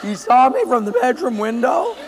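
high, loud stunned disbelief; boy caught out, astonished; shocked boy. Acted. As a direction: gasp it out in shock.

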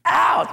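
high hammed-up ow of pain; preacher acting out cutting off an arm, high yelp; mock ow.

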